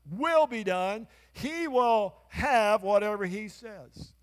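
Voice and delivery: building to loud, high, slow preaching; fiery preaching. Acted. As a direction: drive it home to the congregation. Preaching at full force.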